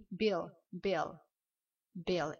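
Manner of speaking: "bill" with a short English i, repeated apart. Shown as a demonstration. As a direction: model "bill" with no u sound. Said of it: "ça se prononce B". (« bill » avec un i court, sans u)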